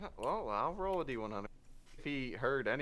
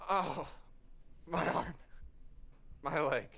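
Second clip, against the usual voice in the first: pained groaning; 3 semitones higher than usual. Acted. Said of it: "Zenith's like".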